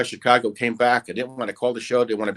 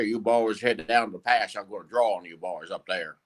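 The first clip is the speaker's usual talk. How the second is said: Western cowboy; 4 semitones lower, 4 dB quieter than usual. Shown as a demonstration. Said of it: "Like a Southerner or cowboy".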